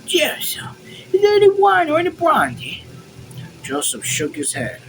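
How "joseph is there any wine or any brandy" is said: very high, loud character voice; loud call. A narrator's reading. Officer asking servant for drink, loud high call. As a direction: call out in a raised falsetto. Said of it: "Crackle said loudly".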